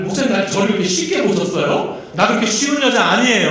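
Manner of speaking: a hammed-up "how dare you"; mock indignant. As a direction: play the insulted woman, half in jest. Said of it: "라고 화를 내더라고요".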